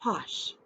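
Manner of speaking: put-on a soft, low "Posh" for children to repeat; modelled word. Shown as a demonstration. Teacher leads children in repeating the word. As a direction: model "Posh" gently for the class to echo. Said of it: "Can you say posh?".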